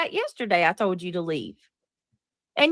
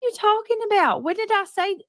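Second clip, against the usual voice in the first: pitched-up, can't-believe-this protest; incredulous outrage; 9 semitones higher than usual. Real, not acted. Streamer to chat accuser, higher incredulous outrage. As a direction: voice jumps way up in angry disbelief.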